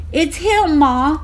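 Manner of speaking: high, loud joyful cry; bursting with the good news; excited girl. A narrator's reading. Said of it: "said Juanita".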